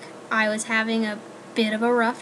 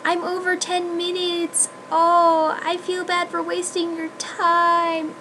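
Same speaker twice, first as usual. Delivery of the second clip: higher, fretful put-on whine; mocking apologetic vlogger; 6 semitones higher, slower than usual. Teasing other vloggers, a higher mock-fretful voice. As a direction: mimic a guilty vlogger, a touch whiny. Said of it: "people are like".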